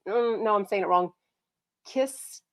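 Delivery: ordinary delivery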